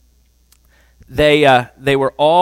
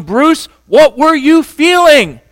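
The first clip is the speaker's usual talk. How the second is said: much higher and louder, slowed demand; demanding yell; 11 semitones higher, 9 dB louder, slower than usual. Retold or imitated. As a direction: shout the question at him. Wife pounds him third time.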